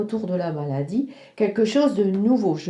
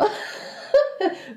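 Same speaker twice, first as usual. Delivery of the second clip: breathy laugh; 11 semitones higher than usual. Real, not acted. Laugh after her own remark.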